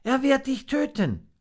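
very high little monkey voice; anxious warning. A narrator's reading. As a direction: plead a warning in a high pitch. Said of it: "warnte Fips".

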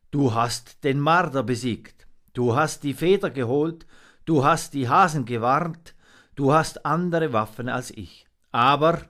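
put-on tally each feat firmly as the old raven; raven mentor, emphatic. A narrator's reading.

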